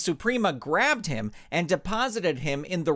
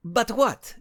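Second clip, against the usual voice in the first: pressing, higher, yet kind; forceful but friendly; 9 semitones higher, 3 dB louder than usual. Acted. Robot presses the nervous coach, forceful but friendly. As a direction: push for the answer without scaring him. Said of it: "L X O said forcefully".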